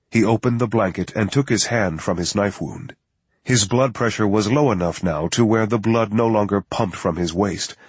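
usual speaking voice

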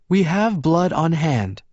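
high, slow female character voice; assured woman's voice, pitched high; Rosalie's voice. A narrator's reading. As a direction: pitch it well up and take it slowly. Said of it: "Rosalie told her".

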